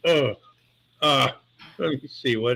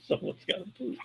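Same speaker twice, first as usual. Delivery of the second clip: breathless, near-silent words squeezed out through laughter; voiceless giggling delivery; wheezing with laughter; 8 semitones higher, 12 dB quieter, faster than usual. Real, not acted. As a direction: barely get the words out for laughing. Grandpa laughing while explaining.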